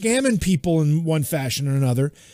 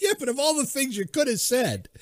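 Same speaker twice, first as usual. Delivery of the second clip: higher and softer, laughing through it; quiet laughing; 9 semitones higher, 6 dB quieter than usual. Real, not acted. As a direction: chuckle the words out. Laughing tease at co-host.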